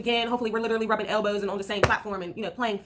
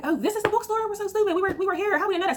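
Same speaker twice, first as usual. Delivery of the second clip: surprised realization; 10 semitones higher than usual. Retold or imitated. Realizing they'd been there already.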